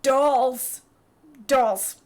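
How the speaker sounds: loud, blurted single word; Alvaro. Acted. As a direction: belt it out as an awkward answer. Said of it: "Alvaro's like".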